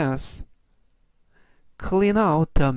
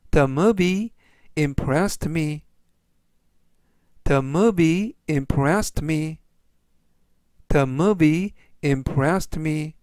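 slow, measured English for learners; teacher's deliberate, word-by-word pace; slow model reading; much slower than usual. Shown as a demonstration. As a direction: read it out slowly for repeat-after-me. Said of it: "천천히 세 번씩 읽어드리고요".

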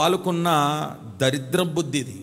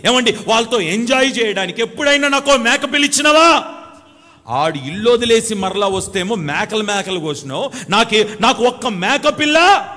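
mock indignant son; 7 semitones higher, 8 dB louder than usual. Preacher voicing the jealous son to the congregation, mock indignant. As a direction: build a petulant jealous complaint to loud. Mimics jealous son's grievance.

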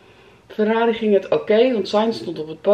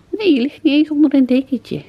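higher, louder sing-song to the dog; high pet voice; 6 semitones higher, 7 dB louder than usual. Real, not acted. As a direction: coo at the pet in a raised voice. Talking to the pet.

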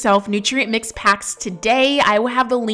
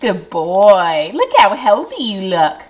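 louder, mildly higher sing-song praise; dog praise; 5 semitones higher, 6 dB louder, slower than usual. Real, not acted. Owner praises Bentley licking his bowl, fond sing-song.

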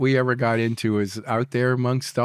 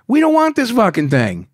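loud, raised-pitch indignant protest; indignant refusal; 8 semitones higher, 6 dB louder than usual. Retold or imitated. Smugglers refusing the gift of an orangutan, loud indignant protest. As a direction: push back hard, retelling the protest. Voicing crew's alarmed refusal of monkey.

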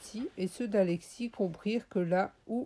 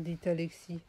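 ordinary delivery, nothing marked

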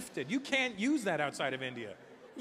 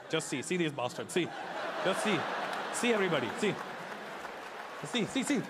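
Indian man pointing. Retold or imitated.

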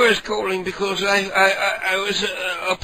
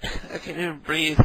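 ordinary talk

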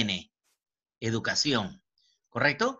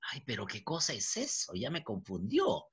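soft, lower aside as a puzzled student; alumno confundido; 3 semitones lower, 8 dB quieter than usual. Acted. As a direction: play a confused student complaining quietly. (alumno confundido, en voz baja)

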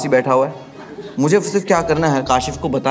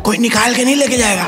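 mild angry retort; 5 semitones higher than usual. Retold or imitated. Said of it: "Hanif को और गुस्सा आ गया".

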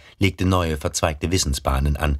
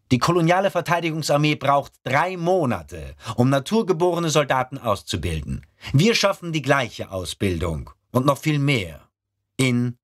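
gefasster, belehrender Offizierston; erkläre es ruhig wie ein Ausbilder; ernster Ausbilder; 8 semitones higher than usual. Acted. Brahe erklärt weiter. (ernster Ausbilderton)

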